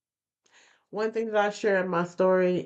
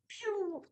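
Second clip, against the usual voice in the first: rising whoosh sound; 13 semitones higher, 11 dB quieter than usual. Retold or imitated. Endorphins through the roof.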